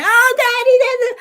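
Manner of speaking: put-on high, loud, fast crying little boy; crying child. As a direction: wail to daddy like a hurt small boy. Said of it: "he went in crying".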